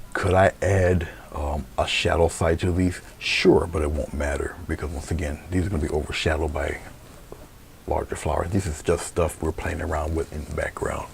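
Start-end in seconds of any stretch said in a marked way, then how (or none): none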